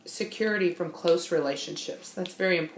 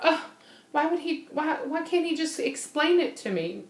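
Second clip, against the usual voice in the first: hurt complaint; 7 semitones higher than usual. Retold or imitated. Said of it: "because I thought".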